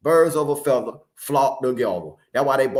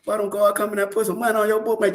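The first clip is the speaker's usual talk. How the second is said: usual speaking voice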